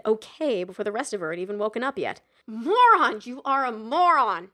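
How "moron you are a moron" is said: loud self-scolding yell; shouting at herself. A narrator's reading. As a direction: yell it at yourself. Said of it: "she shouted at herself".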